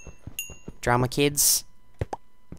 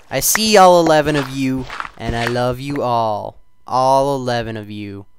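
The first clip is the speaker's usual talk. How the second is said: loud fond shoutout; 8 dB louder than usual. Real, not acted. Addressing viewers affectionately.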